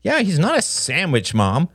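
high, mocking boy's jibe; scornful kid sarcasm; sneering. A narrator's reading. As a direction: sneer at your mother. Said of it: "Justin sneered".

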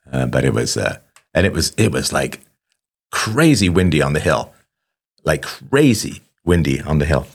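normal talking voice

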